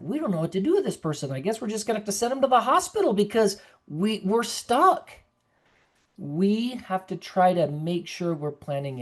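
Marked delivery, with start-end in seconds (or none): 0.0-5.1 rushed, fast mock panic; imitating a flustered director rattling it off; panicky director. Nursing home director about a difficult resident, fast mock panic. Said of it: "that have a panic attack".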